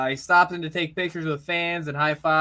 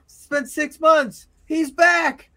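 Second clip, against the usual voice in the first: much higher, louder, astonished call; excited commentator; 7 semitones higher, 5 dB louder than usual. Acted. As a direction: lose it like a stunned ringside announcer. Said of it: "then Tony Schiavone says".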